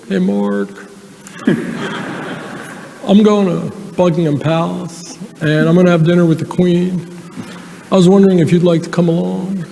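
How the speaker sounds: Bill Clinton impression. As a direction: play Clinton calling a friend, easygoing. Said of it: "I can do his voice really well".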